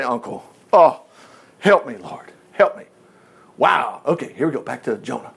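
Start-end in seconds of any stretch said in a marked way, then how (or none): 0.0-2.8 low, mildly acted plea; plea of surrender. Sinner giving up and asking the Lord for mercy, lightly acted plea. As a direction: give in with a low cry for help. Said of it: "You just gotta say".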